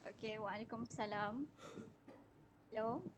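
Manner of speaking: faint voice away from the mic; distant, quiet call audio; far from mic. Real, not acted. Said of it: "cuba dekatkan mic sikit ke".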